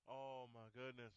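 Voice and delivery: low-toned, softly spoken commentator impression; Stacey King. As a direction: drop it low and quiet, like an aside on air. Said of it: "Stacey King in a low tone".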